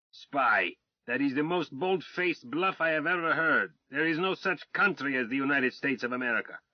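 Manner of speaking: a blustering Tsarist officer shouting insults; arrogant Russian colonel. Acted. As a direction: rage at the British swine with haughty contempt. Colonel arrests them.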